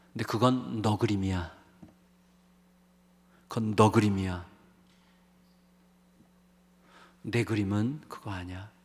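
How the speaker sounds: a lightly voiced God speaking gently to Elijah; hushed, fatherly correction; gentle voice of God. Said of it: "이렇게 얘기하시는 거예요".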